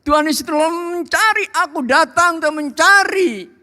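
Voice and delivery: very high, ringing proclamation; full-force preaching. Real, not acted. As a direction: proclaim it fervently to the congregation.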